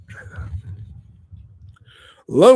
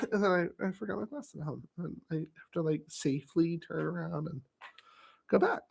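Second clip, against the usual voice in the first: sheepish, much softer; 10 dB quieter than usual. Real, not acted. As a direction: drop to a sheepish murmur. Sheepish admission after shout.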